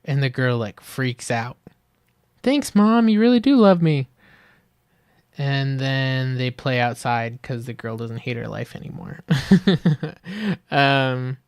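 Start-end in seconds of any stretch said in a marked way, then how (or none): 2.4-4.1 much higher, louder overjoyed thanks; hammed-up grateful child; playful over-the-top gratitude; mock gushing kid. Girl thanks mom in retold ad. 9.2-10.7 high bright laugh at herself; real amused chuckle. Real, not acted. Laughs at own retelling.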